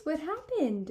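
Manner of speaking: plain reading voice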